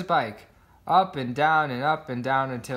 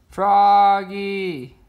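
loud, higher call; yell; 7 semitones higher, 8 dB louder than usual. A narrator's reading. A father yelling to his son on the bike, loud yell. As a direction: yell his name after letting go. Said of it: "he yelled".

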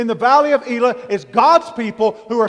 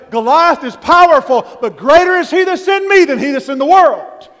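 higher and louder declaration of faith; bold proclamation; 4 semitones higher, 7 dB louder than usual. Acted. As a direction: proclaim it like a battle cry.

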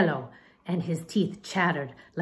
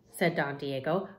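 ordinary delivery

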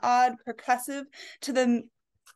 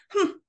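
grumpy humph; 10 semitones higher than usual. Acted. Old lady grumbles.

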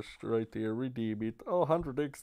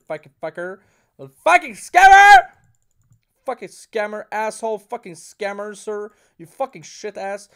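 loud furious swearing rant, much higher; swearing tirade; 7 semitones higher, 13 dB louder than usual. Real, not acted. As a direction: scream abuse at the slot. Raging at slot loss.

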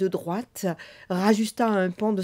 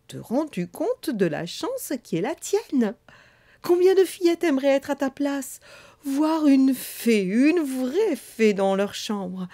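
a higher, slow, sing-song fairy voice; pleading fairy; 5 semitones higher than usual. A narrator's reading. Fairy persuading the bored girl, higher and sweeter. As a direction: dazzle her with your wonder.

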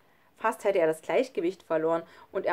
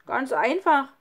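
loud, higher call from above; von oben gerufen; 6 semitones higher, 7 dB louder than usual. A narrator's reading. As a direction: call down breezily to a friend. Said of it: "rief Schlunz von oben". (laut von oben herabgerufen)

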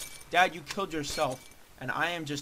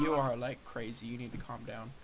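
ordinary delivery

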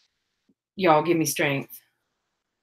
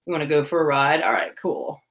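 voicing laid-back horse; 5 semitones lower than usual. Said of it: "He's like".

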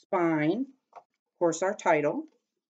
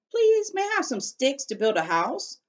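polite little javelina; 9 semitones higher than usual. Acted. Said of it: "The second little javelina said".

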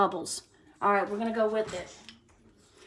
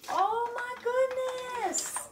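very high, stretched-out cry; slow, high-pitched outburst; drawn-out exclamation; 14 semitones higher, much slower than usual. Real, not acted. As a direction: break off into a long, high exclamation. Breaks off mid-sentence to exclaim.

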